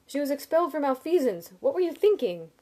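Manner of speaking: high, loud, appalled outburst; shrill yelling. A narrator's reading. Sim scolding his friend over the loan, shrill yelling. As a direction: yell it at full alarm, voice high. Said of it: "am I yelling too loud".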